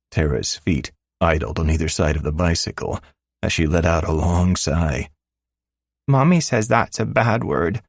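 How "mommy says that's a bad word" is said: put-on high child voice, scolding; a six-year-old correcting her dad; tattling little girl. Daughter telling her father he said a bad word, high child voice.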